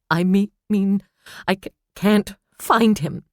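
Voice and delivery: high, loud broken stammer. A narrator's reading. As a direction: stumble over the words in panic. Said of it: "she stammered".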